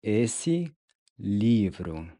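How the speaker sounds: ordinary delivery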